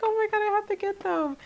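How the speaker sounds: ordinary delivery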